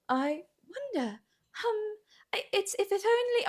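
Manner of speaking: hushed, very high, dragging mock voice; audiobook narrator imitation. Mocking the audiobook's heroine, slow airy-headed imitation. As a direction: linger softly and high on every word. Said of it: "she talks like this".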